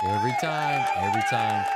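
belted out, high then loud; loud catchphrase. Acted. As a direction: shout it like a catchphrase. Weird shouted catchphrase.

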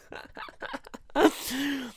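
high, wheezy laugh. Real, not acted. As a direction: crack up at your own joke. Laughs at own French mockery.

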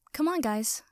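ordinary delivery

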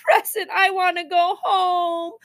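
high, slow tearful whine; drawn-out sobbing complaint, building to a wail; child crying. A narrator's reading. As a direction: wail like a hurt little boy. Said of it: "Sam cried".

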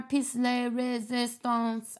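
"piece de resistance" said slowly with English sounds, not French; clumsy French phrase. Real, not acted. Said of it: "I never say that phrase right".

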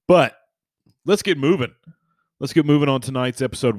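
bursting back in at full volume; loud and energetic. Real, not acted. Loud energetic transition to episode.

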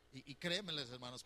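usual speaking voice

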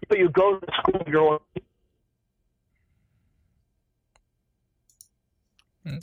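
bad phone line cutting out; words dropping out through static; breaking up. Real, not acted. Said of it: "Sorry, you're breaking up".

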